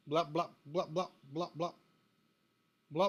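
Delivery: blipping like a cut-out line; mock call dropout noise. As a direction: imitate a dropping call with blops. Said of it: "you're in and out".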